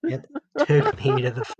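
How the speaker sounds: real helpless laughing. Real, not acted. Recalling a friend's slang for hands, real helpless laughing. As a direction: crack up mid-sentence.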